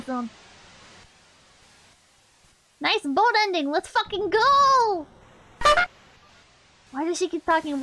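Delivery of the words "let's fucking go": hype. Real, not acted. Cheering a good boat ending.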